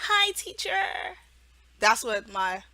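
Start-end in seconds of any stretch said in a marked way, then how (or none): 0.1-1.2 put-on higher, slow little-kid "Hi, teacher"; child's reply. Mock mentor acting as a child, high and slow. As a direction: answer like a shy young student. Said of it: "and then they go".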